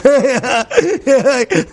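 high laugh at his own joke. Real, not acted. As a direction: burst out laughing.

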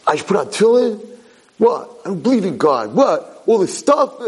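imitating an angry kid's protest. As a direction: play an argumentative kid firing back. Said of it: "He's angry".

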